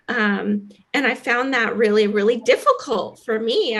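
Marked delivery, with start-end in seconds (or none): none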